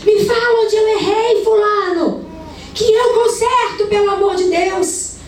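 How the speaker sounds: mother yelling at her son over a school note; retold yelling mother. A mother on the balcony yelling at her son, high shrill scolding. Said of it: "E ela gritando com o menino".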